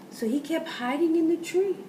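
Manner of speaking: plain reading voice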